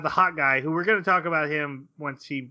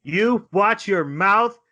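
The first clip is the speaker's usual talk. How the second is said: slow, loud and higher, playfully stern; mock scolding; 9 semitones higher, 8 dB louder than usual. Teasing a cohost who insulted her favorite character, mock stern. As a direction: wag a finger at your friend in jest. Defending a beloved anime character.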